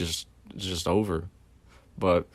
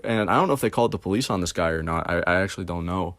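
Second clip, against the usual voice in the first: usual speaking voice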